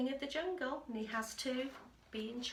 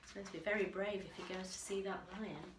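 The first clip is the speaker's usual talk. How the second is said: usual speaking voice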